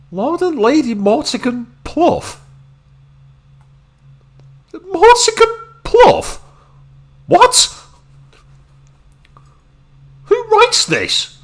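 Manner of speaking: high, disbelieving squeak; baffled, mocking the silly name; incredulous. Real, not acted.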